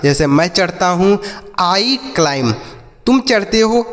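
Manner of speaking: usual speaking voice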